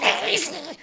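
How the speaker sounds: squawky, high duck quack; Donald Duck impression. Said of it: "that was the worst Donald Duck impression ever recorded".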